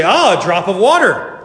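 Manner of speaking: loud, high exclamation; delighted discovery. Pretend. Companion answering the guide about the blossom, delighted discovery. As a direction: exclaim as if just spotting it.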